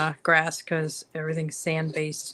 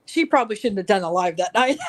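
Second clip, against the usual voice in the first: louder, tickled, breaking into laughter; laughing; 6 dB louder than usual. Real, not acted. Telling a friend about a tipsy live video, laughing. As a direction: say it as a real laugh takes over. Cracking up at funny story.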